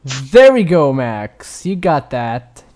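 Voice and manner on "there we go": loud, high cheer; excited cheer. Real, not acted. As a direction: cheer him on loudly. Cheering on a gross joke.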